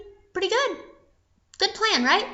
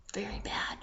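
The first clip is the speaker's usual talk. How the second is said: low, very soft aside; grave hush; 5 semitones lower, 16 dB quieter than usual. A narrator's reading. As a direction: let it sink to a whisper. Hushed grave narration.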